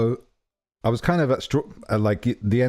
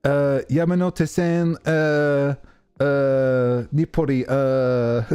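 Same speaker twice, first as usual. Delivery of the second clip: higher, halting imitated Japanese accent; 4 semitones higher, much slower than usual. Japanese guy struggling to give directions in English, Japanese accent. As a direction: mimic broken Japanese-accented English with drawn-out hesitations. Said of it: "He just wouldn't give it up".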